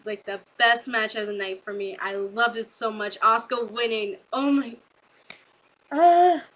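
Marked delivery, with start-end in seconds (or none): 5.9-6.4 high, loud squeal of delight; excited squeal. Real, not acted. Vlogger squealing to viewers over Asuka's win, loud and high. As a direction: let out a real shriek of joy. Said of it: "I'm so happy".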